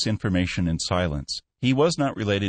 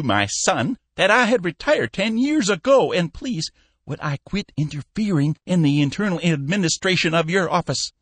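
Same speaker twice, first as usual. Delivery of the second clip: lightly voiced old man, a bit higher; a hint of a cranky old shipowner grumbling; fussy old boss; 12 semitones higher, 5 dB louder than usual. A narrator's reading. Cappy grumbling at his managers, lightly raised voice.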